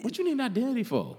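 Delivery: high, fast, laughing tease; laughing mock question. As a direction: toss it off laughing.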